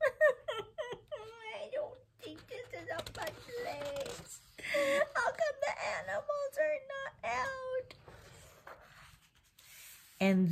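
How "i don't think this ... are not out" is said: high giggly pretend child; laughing child voice. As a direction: giggle through it like a little kid.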